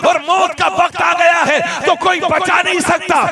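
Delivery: fiery preaching. Acted. Preacher urging the congregation to keep faith, shouting. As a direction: build to a full-throated sermon climax.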